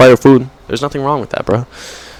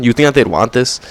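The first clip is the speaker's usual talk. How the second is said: ordinary talk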